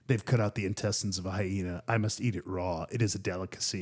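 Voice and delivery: deadpan explorer announcing he must eat it; mock Bear Grylls. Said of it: "where Bear Grylls are like".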